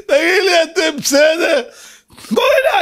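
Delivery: high, loud exclamation at the crowd; hammed-up high-pitched outburst; mock outraged shouting. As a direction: holler in comic disbelief.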